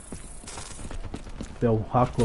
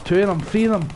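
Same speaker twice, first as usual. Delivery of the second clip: excited gloating; 8 semitones higher than usual. Real, not acted. Gloating over kills.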